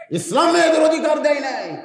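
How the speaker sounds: high, loud mimicked protest; loud mock outcry. Preacher mimicking critics of Islam, loud high outcry. Repeats critics' claim as a cry.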